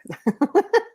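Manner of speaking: high, giggly laugh. Real, not acted. Host admits staying up late playing VR, laughing.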